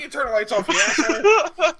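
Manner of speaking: loud, high, laughing insult; slowing into a laughing shout on asshole; laughing mock yell. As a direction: yell it while cracking up. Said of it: "I'm like".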